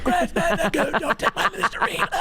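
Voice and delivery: high strangled gasping, laughing; mock dying words. Pretend suffocating man's last words to roommate, choking. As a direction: squeeze out absurd last words, hammed-up choking. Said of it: "last thing you heard".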